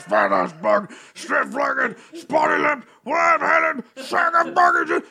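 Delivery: shouting furious Hulk, voice cracking at the top; Hulk rant. Acted. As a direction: build from a gruff growl to a roaring Hulk meltdown. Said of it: "I'm gonna do it as the Hulk".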